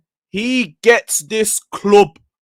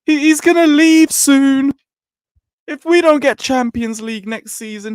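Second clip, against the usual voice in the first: higher, whiny mock panic; mocking scaremongers; 9 semitones higher than usual. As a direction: parody the doom-mongering fans. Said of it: "you scaremongering motherfuckers".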